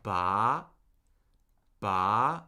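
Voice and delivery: "Ba" gliding steadily upward; questioning upward lift on Ba; second tone. Shown as a demonstration. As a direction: start low and rise through each "Ba". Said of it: "what it means to rise".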